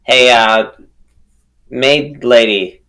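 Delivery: normal talking voice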